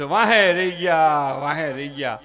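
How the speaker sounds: low, slow mock wail of missing out; a greedy man mocked for missing out, slow mock lament. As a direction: moan like a greedy man left behind.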